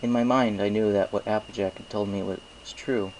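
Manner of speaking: ordinary delivery, nothing marked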